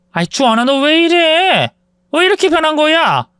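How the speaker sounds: hurt mother asking why he changed; shocked mother. Retold or imitated. Mother shocked by son's outburst. (아들의 변화에 놀라 높고 느리게 묻는 엄마)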